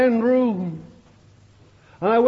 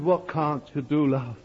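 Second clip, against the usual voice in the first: gentle comfort; 6 semitones lower, 5 dB quieter than usual. Retold or imitated. Pastor gently comforting sobbing woman.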